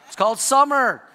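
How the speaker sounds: laughing through the punchline. Real, not acted. Pastor laughing at his own joke. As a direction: chuckle out the word summer.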